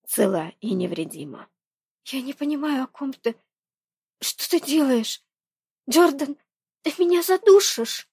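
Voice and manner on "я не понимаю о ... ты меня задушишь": put-on higher, alarmed young woman; rising to a squeezed cry. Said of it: "Гулко ответила Рейни".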